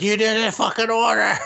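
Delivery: high, shouted mock outburst. The possessed owner yelling at the waitress, high mock shout. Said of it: "He's like".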